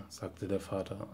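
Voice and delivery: ordinary delivery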